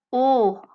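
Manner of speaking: O held with tensely rounded lips; rounded O vowel. Shown as a demonstration. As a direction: let air out through a rounded O. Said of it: "kind of rounded".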